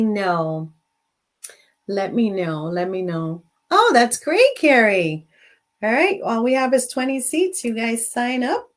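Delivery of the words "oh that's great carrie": bright high burst of delight; light up at the viewer's comment; excited. Real, not acted. Host thrilled to see Carrie's comment, excited.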